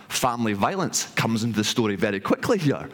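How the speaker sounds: plain reading voice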